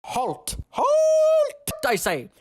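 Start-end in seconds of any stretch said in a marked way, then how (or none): 0.7-1.7 very high, loud shout; shouted command. Acted. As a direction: bellow the order to stop. Guards challenge approaching stranger.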